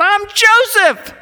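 slow, high, loud shout; full-voiced cry of revelation; yelled revelation. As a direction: yell it out, stretching the name. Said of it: "and he yells out".

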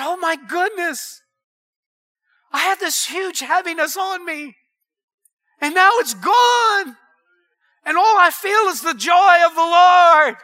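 imitating an overwhelmed, jubilant testimony; retold joyful relief. Voices healed woman, relief building.

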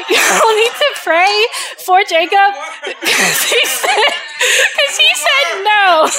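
laughing through the words; high laughing delivery. Real, not acted. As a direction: giggle while telling it. Laughing at boyfriend's answer.

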